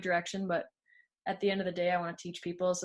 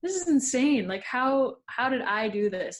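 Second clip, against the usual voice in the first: higher, louder burst of astonishment, easing off; amazed disbelief; 5 semitones higher, 5 dB louder than usual. Retold or imitated. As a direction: retell your own stunned reaction with real amazement. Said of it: "I'm just like".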